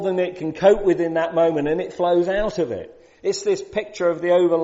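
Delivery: fervent pulpit proclamation; preaching at full force. Real, not acted. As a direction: preach it with rising fire.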